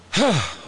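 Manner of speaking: acted sigh of relief; relieved sigh. King hearing the baby is dead, relieved sigh. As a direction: let out a big relieved sigh.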